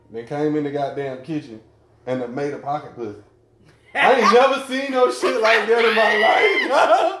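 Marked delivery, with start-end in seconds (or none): 3.9-7.2 loud laughing disbelief, pitch up high; laughing outburst. Real, not acted. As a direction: shout it through real laughter. Laughing disbelief at friend's story.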